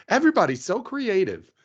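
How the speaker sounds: high-pitched imitation of an online reviewer; lilting mock admiration, pitched well up; creative trend lady. Imitating the lady from the trend, high mock praise.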